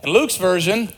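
normal talking voice

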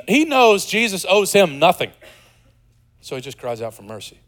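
ordinary delivery

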